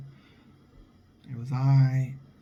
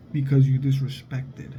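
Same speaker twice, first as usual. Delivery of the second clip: normal talking voice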